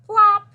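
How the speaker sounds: big excited plop sound; high loud plop. A narrator's reading. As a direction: shout the plop like it just landed. Sound effect in picture book.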